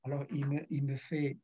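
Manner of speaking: ordinary talk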